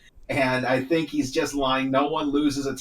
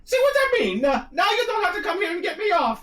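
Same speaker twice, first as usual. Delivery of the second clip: Mario voice; 4 dB louder, faster than usual. Acted. Mario voiced in fanfic reading.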